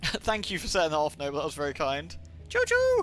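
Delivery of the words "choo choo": high-pitched choo-choo toot; playful train whistle call. Pretend. Train whistle imitation while thanking donors.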